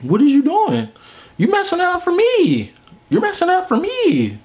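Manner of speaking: hammed-up high-pitched pleading; exasperated whine. Telling his roommate off near gorgeous women, whining. Complaining to roommate about girls.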